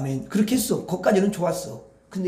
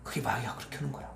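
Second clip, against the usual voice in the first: hushed whisper about the devil; whispered warning about the devil; eerie whisper; 6 semitones lower, 12 dB quieter than usual. Acted. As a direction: drop to a secret whisper.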